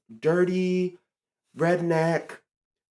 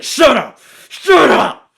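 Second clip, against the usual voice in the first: loud, high-pitched furious snap; mom's furious shout; 8 semitones higher, 12 dB louder, much slower than usual. Retold or imitated. Mom silencing her kid mid-protest, furious shout. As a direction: shout it through clenched teeth in pure fury. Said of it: "Says it just like that, like, the same teeth and everything".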